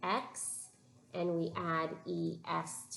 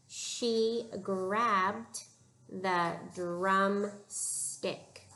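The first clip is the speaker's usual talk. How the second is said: drawn-out reading of "She grabbed the drumstick"; slow read-along; much slower than usual. Shown as a demonstration. Teacher reading along with the class, very slowly. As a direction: stretch every word so the kids can follow. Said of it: "Read it with me".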